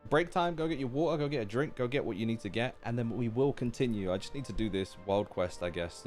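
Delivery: ordinary talk, nothing marked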